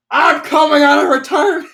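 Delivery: high, loud, heroic declaration; triumphant declaration. Retold or imitated. Derpy to Starfall, triumphant heroic declaration. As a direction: announce your big comeback.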